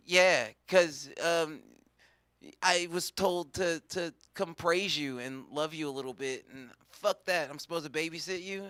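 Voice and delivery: ordinary talk, nothing marked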